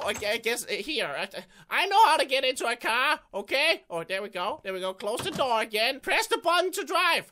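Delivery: ordinary delivery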